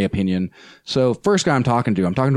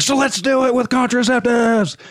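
high called-out heckle; much higher yelled heckle at the rally; retold yell; 14 semitones higher than usual. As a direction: shout it across the room as a heckle. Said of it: "I yelled out".

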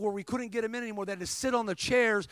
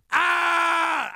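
loud, high-pitched "ah" yell; imitating a man's startled scream; imitated scream; 8 semitones higher, 7 dB louder than usual. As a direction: act out a sudden held cry. Retelling man's cry as back heals.

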